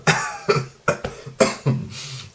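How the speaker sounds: high choking cough on food. Real, not acted. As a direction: cough like food went down wrong. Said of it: "he's gonna choke on his nuggets".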